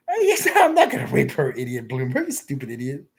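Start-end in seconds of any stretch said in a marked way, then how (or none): 0.1-1.5 laughing through the words, higher and louder; cracking up mid-sentence. Real, not acted. Streamer looking at a photo, laughing out loud. Laughs at his own joke.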